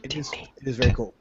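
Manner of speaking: whispered, breathless agreement. Real, not acted. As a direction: say it almost under your breath. Quiet agreement.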